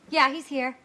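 ordinary talk, nothing marked

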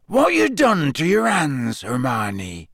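big gamekeeper asking with concern; Hagrid voice. A narrator's reading.

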